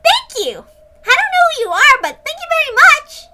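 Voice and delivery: put-on high, loud, bubbly girl; Rena's voice. Rena thanks the stranger who saved them, high and gushing. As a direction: gush your thanks like an excited young girl.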